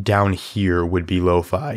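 normal talking voice

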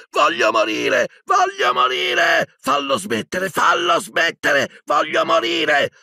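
high, drawn-out agonised cries; anguished screaming. A narrator's reading. Professor begging for it to stop, anguished screaming. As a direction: scream it out in torment. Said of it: "cominciò a gridare, più straziato che mai".

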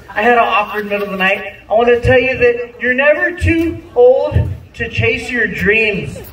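ordinary delivery

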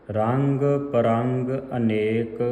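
slow, sustained recitation resting on ਅਨੇਕ; drawn-out ਅਨੇਕ before the pause; pause after ਅਨੇਕ. Shown as a demonstration. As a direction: stretch each word, rest after ਅਨੇਕ. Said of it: "ਇੱਥੇ ਵਿਸਰਾਮ ਹੈ".

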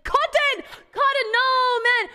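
eager surf instructor yelling from behind; instructor calling out. Retold or imitated. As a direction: shout her name to bring her back. Instructor calling her back.

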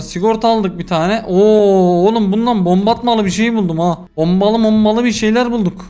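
ordinary delivery

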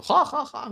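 risa aguda y breve de burla. Real, not acted. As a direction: suelta una carcajada aguda de broma. Se ríe de su propia broma. (carcajada corta y aguda)

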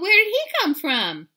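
high, loud startled question; surprised. Acted. Sister startled by the kitten, high and loud. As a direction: ask it startled.

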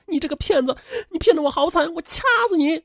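high, strained outburst without extra volume; hysterical wailing. A narrator's reading. Cheated woman attacking the man who fooled her, hysterical. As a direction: screech accusations in a put-on woman's voice. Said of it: "嘴里歇斯底里的嚎叫着".

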